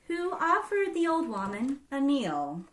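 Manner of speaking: ordinary delivery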